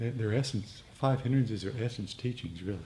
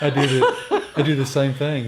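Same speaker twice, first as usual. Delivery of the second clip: laughing; 4 semitones higher, 12 dB louder than usual. Real, not acted. Laughing admission of same habit.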